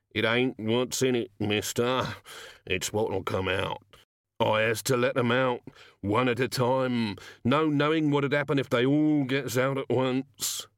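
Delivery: glum, even-toned gnome; resigned gnome. Acted. Gnome explaining what is in the bag, resigned. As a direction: explain it wearily, drawing out the endings.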